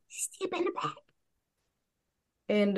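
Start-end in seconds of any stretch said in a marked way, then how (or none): 0.1-0.9 high, hushed character whisper. Acted. Stella secretly tells a companion to step back, whispering. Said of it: "she whispers to Roy Yome".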